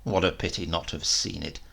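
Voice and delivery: ordinary delivery, nothing marked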